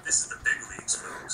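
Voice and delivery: hushed dramatic whisper. Acted. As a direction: whisper it like a secret. Whispered hype line.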